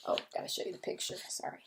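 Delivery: quick, hushed aside; hurried whispered aside. Real, not acted. Reader pauses the story to show the picture, hushed. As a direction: slip it in fast and low.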